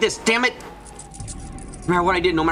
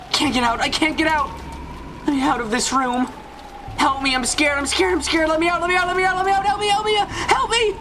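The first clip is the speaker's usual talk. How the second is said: very high, crying, pleading ever faster; hysterical terror. Acted. Locked in the dark room, sobbing to be let out. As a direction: break down into sobbing begging.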